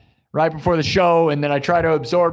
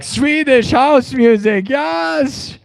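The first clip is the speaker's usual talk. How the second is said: high, slow hammed-up Swedish accent; exaggerated Swedish fan voice; 9 semitones higher, much slower than usual. Streamer imitates PewDiePie raving about Swedish house music. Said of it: "and he's like".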